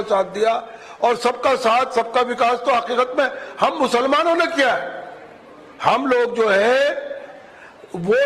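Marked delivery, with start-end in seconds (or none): 5.8-7.1 drawn out, word by word slow. Real, not acted. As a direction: stretch every word slowly. Replayed, strongly slowed.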